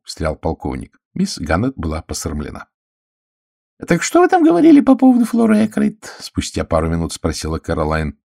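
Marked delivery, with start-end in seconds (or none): 3.9-6.2 high, loud eager question; dying to hear the gossip; eager prompting. A narrator's reading. Caroline presses Miss Gannett for gossip, high and loud.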